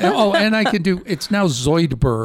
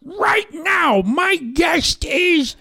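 Gilbert Gottfried. Acted. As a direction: screech it out far above your normal pitch. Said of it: "do a Gilbert Gottfried".

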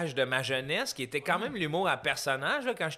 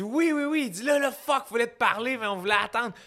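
gleeful roommate; 7 semitones higher than usual. Retold or imitated.

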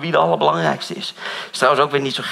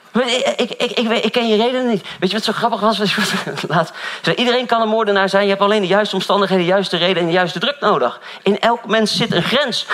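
preaching; 7 semitones higher than usual. Acted. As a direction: drive the point home from the pulpit. Preaching voice rises again.

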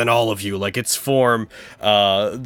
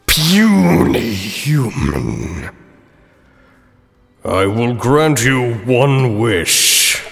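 slow, booming genie; grand, slow, condescending spirit; grandly granting a wish; 3 dB louder, slower than usual. Acted. Genie speaks.

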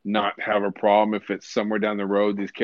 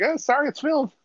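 high mock apology. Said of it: "they're like".

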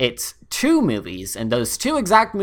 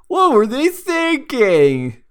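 YouTuber voice; 9 semitones higher, 4 dB louder, slower than usual. Retold or imitated. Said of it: "and him just saying".

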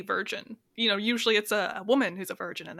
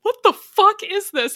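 shocked disbelief; 11 semitones higher, 11 dB louder than usual. Retold or imitated. Friends stumble on bizarre scene.

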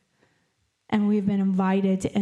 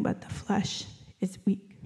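hushed whisper; 7 dB quieter than usual. Acted. Jesus warning sleeping disciples.

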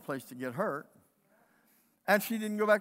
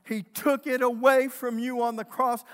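louder, higher restatement hammered home; preached proclamation; 6 semitones higher, 9 dB louder than usual. Acted. Preacher declaring it to the congregation, louder proclamation. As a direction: declare it to them as truth. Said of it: "Do you know he took it away from you on the cross".

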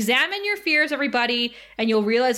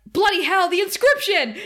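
high, urgent mock alarm; alarmed outburst; 7 semitones higher, 4 dB louder than usual. Giles realises he missed the inscription, acted alarm. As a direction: exclaim as if just spotting a mistake.

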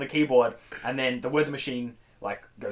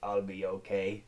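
slow, soft, low voice; barely recovered, taking it slowly; weak and shaken; 6 semitones lower, 8 dB quieter, slower than usual. Acted. Hurt Math Dog reassuring Cody, weakly. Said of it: "so he's shaking".

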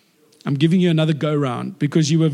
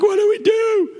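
panicked plea; slower than usual. Retold or imitated. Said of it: "And they're like".

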